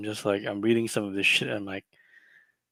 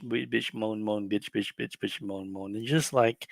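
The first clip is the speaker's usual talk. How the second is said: ordinary delivery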